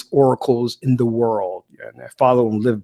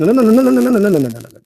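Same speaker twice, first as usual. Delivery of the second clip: loud, high rapid string of no; insistent no; 8 semitones higher, 6 dB louder, much faster than usual. Retold or imitated. Cutting off the man who accuses him, loud insistent no. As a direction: shut him down with a burst of no.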